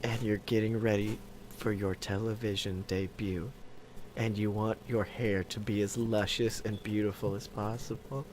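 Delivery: soft ASMR voice. Pretend. ASMR scene setting.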